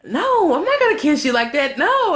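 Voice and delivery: high, emphatic imitation of refusing; hammed-up high-pitched objection; acted refusal. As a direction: refuse loudly in mock disgust. Retelling girlfriend's refusal of a kiss.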